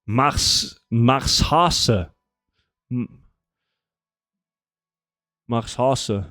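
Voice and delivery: name read with a German accent, mars-HAH-seh; mock German reading of the name. Shown as a demonstration. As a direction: say Marshase like a German would. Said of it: "I'm doing it with a German accent".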